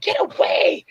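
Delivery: high mock squeal of protest; indignant lady. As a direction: imitate a pinched woman's yelp. Voices pinched lady fending him off.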